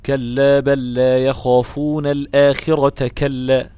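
slow chant with the stop on the final كلا; wrong stop on كلا. Shown as a demonstration. Teacher shows the ugly stop again, slowly chanted. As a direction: recite slowly, stopping on the last كلا. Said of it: "وقف قبيح طبعًا".

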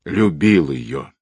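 slow, pained groan. A narrator's reading. Dying killer confessing to the colonel, groaning. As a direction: draw the words out weakly. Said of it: "простонал тот".

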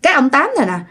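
usual speaking voice

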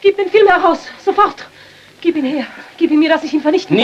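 snatch at it with your voice; frantic demand. Acted. Widow demands the film frantically.

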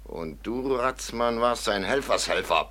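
normal talking voice

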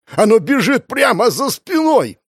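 high, frantic yell; panicked scream. Acted. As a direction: scream in terror of the pursuer. Hunted man screams in panic. (панический крик)

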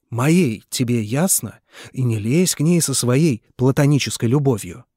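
loud, hard-pressed insistence; angry retort. Acted. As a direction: hammer each point home at him. Nikolai shouts back. (Николай громко и зло отчитывает Митю)

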